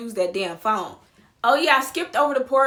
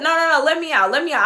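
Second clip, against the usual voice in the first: loud, raised repeated demand; insistent refusal; 5 semitones higher, 6 dB louder, faster than usual. Retold or imitated. Passenger refusing the driver's plan, insistently. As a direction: cut him off, loudly insisting.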